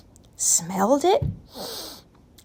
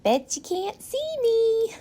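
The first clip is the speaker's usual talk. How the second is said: put-on high, teasing little boy voice; a boy hiding and taunting; teasing child; 12 semitones higher than usual. A narrator's reading. Owen pretends to be invisible, a high teasing child voice.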